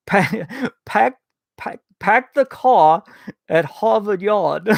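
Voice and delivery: halting, slow attempt at a Boston accent. Shown as a demonstration. Host trying a viewer's phrase, badly faked Boston accent. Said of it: "is the Boston accent".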